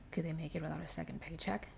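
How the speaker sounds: plain reading voice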